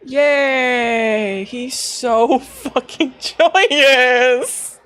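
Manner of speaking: very slow and high, laughing through it; laughing delight. Real, not acted. Player laughing at the joyous character, slow and high. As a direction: gush with laughing joy.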